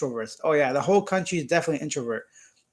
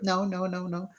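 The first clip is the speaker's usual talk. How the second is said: put-on girl voice; 5 dB quieter than usual. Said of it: "I'm just trying to sound like a girl".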